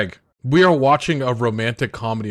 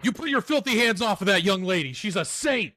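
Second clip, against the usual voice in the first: mock protective outrage; 8 semitones higher than usual. Man defending his student from his friend, mock indignant. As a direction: scold in a strained high voice, hammed-up. Acts out protective outburst over young lady.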